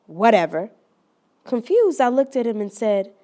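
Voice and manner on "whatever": low, curt brush-off; dismissive reply. Retold or imitated. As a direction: shrug the greeting off. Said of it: "He replies".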